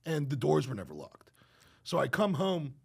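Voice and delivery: ordinary talk, nothing marked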